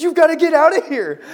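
high, loud order to hurry; acted urgent yell. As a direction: yell at a friend to get moving. Hurrying friend out for flight.

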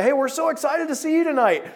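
mock excited friend. Friends calling about tonight's plans.

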